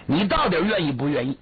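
fast, impatient demand; exasperated brother pushing for an answer, quick; impatient pressing. Acted. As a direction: rush the question, fed up.

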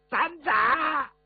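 a sudden high shout in a strange half-eunuch, half-hoarse voice; shrill dwarf voice. Acted. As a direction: squeal it out like the little man. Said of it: "我还学不出他那动静来". (尖声怪叫的小人国腔调)

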